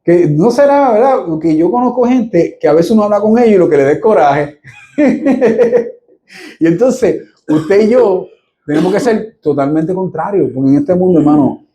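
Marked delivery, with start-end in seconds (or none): 5.0-5.9 a short high burst of laughter. Real, not acted. Pastor laughing about people who make you angry, a high laugh. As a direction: let a quick laugh escape.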